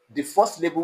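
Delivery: normal talking voice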